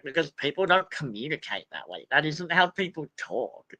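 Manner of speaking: ordinary delivery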